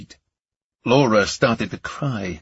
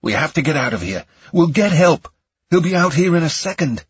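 hurried, growing higher and louder; raised, frantic persuasion; urgent insistence; 6 semitones higher, 4 dB louder than usual. A narrator's reading. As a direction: press her to flee, panicking. Urging escape from gunman.

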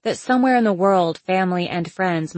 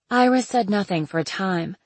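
ordinary delivery

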